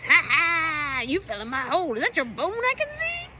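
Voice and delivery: high, loud cackling taunt; gloating laugh. Retold or imitated. Wombat mocking the fallen runner, loud cackle. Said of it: "and he goes".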